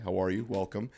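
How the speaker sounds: normal talking voice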